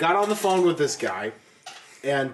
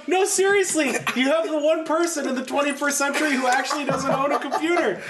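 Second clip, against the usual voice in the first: laughing quote; 11 semitones higher than usual. Retold or imitated. Mom voiced laughing on phone.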